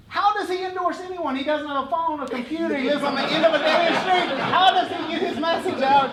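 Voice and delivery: high, building exasperated protest; incredulous rant. Retold or imitated. Son hears his dad endorsed someone, incredulous rant. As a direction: build from baffled to loud exasperation.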